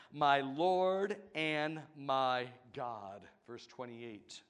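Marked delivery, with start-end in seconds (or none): 0.1-3.3 slow, weighty worship words; reverent declaration. Retold or imitated. Thomas worshipping Jesus, slow and reverent. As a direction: declare it slowly in awe.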